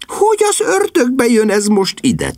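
exasperated. Acted. Sister exasperated.

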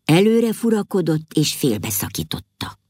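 ordinary delivery, nothing marked

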